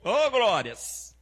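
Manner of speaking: high ringing preacher's cry; preaching at full force. Acted. Pastor calls out to the church, preaching at full force. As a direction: lift the church with the promise.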